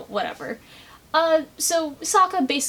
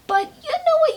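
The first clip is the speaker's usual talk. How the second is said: sudden high, louder burst; excited exclamation; 5 dB louder than usual. Real, not acted. Vlogger cheering the reunited couple, high and loud. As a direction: jump in with a bright cry to the characters. Addressing the characters directly.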